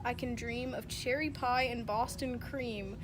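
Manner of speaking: ordinary talk, nothing marked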